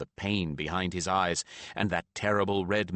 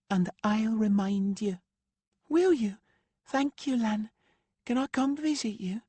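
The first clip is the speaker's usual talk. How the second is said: put-on high, eager young girl voice; excited girl asking a favour. A narrator's reading. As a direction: bubble over with eagerness. Macy eager to visit.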